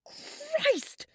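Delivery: high, loud startled shriek; frightened scream. Acted. Startled by a friend in the dark, screaming in fright. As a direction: scream as if someone jumped out at you. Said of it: "standing here in the dark screaming".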